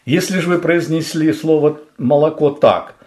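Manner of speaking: ordinary delivery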